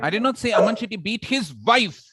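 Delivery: emphatic final "wife"; stress on WIFE. Shown as a demonstration. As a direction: build high, then land hard on "wife". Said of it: "He might have beaten his sister".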